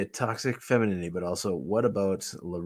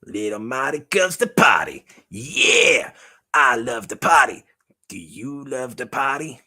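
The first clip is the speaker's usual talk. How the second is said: loud, higher-pitched hype; party-animal puppet; 4 semitones higher, 6 dB louder than usual. Pretend. Puppet hyping up the audience for a party, excited. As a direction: whoop it up as a party-loving toy.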